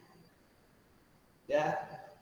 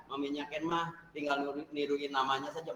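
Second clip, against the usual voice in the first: usual speaking voice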